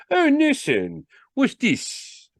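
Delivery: very high-pitched character voice; high, theatrical sales patter; showy salesman. Acted.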